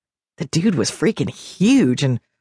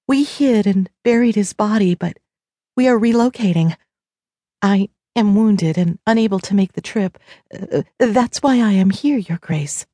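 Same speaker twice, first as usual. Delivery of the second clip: higher voice, a touch of sorrow; understated tearful deer shifter appeal; 6 semitones higher than usual. A narrator's reading. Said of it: "eyes filled with tears as she went on".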